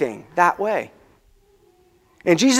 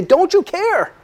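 high frantic cry; frantic plea; 11 semitones higher, slower than usual. Retold or imitated. Disciples waking Jesus, frantic high plea. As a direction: plead frantically with the sleeping Jesus. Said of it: "wake him up frantically".

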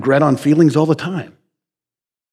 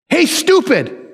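high taunting call, much higher; mocking Wisdom; 11 semitones higher, slower than usual. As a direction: call out to a fool teasingly. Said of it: "And you know what it says?".

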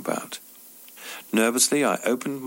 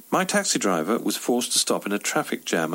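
ordinary delivery, nothing marked

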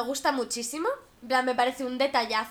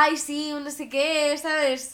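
ordinary delivery

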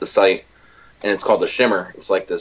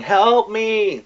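high, drawn-out mock cry for help; imitated yell; 12 semitones higher than usual. Podcaster acting out the movie victim in the background, mock yelling. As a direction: imitate a far-off victim yelling, pitched way up without real volume. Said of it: "you hear Shepard yelling".

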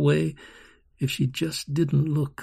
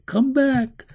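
high, pleading cry; a child crying out to someone leaving; desperate cry; 9 semitones higher, 6 dB louder than usual. Acted. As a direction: call out in distress. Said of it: "her own cry".

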